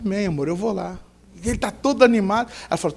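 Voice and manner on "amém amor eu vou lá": soft, agreeable wife's reply; lightly voiced, gentle yes; loving compliance. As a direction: give in softly, for love. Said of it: "Aí ela, por amor".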